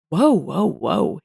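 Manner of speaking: a touch of alarm, falling off; mild exclamation. A narrator's reading. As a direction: object with understated surprise. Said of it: "he exclaims".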